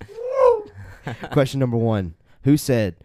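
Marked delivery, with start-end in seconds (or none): 0.1-0.7 excited cheering. Real, not acted. Host cheers the contestant.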